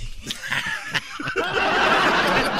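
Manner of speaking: shrieking laughter. Real, not acted. Laugh after joke.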